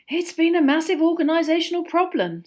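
high, loud exasperation; put-upon complaint; weary sigh. A narrator's reading. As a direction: let the burden out in a sighed remark. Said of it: "sighed Mister Weasley".

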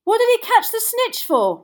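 high, loud baffled protest; bellowing. A narrator's reading. Boy questions the catch while applauding, bellowing. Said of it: "Ron bellowed".

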